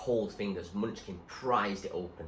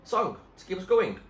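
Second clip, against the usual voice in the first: louder, higher keen suggestion; eager call; 11 semitones higher, 10 dB louder than usual. Acted. Munchkin urging Zoe to sing while rowing, louder and eager. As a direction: pitch the idea with gusto.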